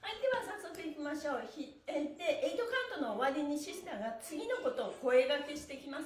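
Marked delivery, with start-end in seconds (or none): none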